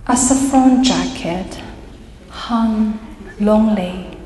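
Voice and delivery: spaced-out recital in short phrases; deliberate poetic delivery, phrase by phrase; poem reading. Acted. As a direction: read verse with long pauses between fragments. Reads own poem aloud.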